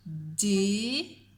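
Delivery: découper said slowly and clearly; the last repeat of découper for the class; dictation voice. Shown as a demonstration. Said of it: "Je répète une dernière fois".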